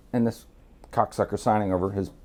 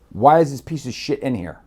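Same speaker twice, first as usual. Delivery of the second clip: loud, high angry rant; furious outburst; 5 semitones higher, 8 dB louder than usual. Retold or imitated. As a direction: rage that he's even in the room. Demanding Mario leave.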